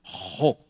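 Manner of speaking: Ro with a strongly aspirated R, low; bem aspirado. Shown as a demonstration. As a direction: push air through the R of Ro. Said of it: "Ó, bem aspirado". ("Ro" com R bem aspirado)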